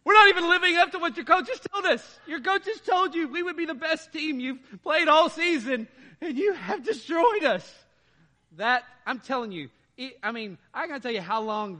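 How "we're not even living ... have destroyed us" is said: high, loud mock taunt with laughter; laughing, exaggerated imitation of the taunting player. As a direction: ham up the gloating. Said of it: "Like, like".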